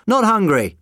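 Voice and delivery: high, loud yell back. A narrator's reading. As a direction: shout it back through the door. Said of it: "the doctor yelled back".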